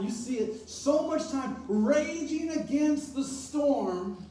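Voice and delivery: emphatic, building sermon voice; preacher rallying the congregation; fervent preaching. Acted. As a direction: drive home each struggle. Preaching about the storms.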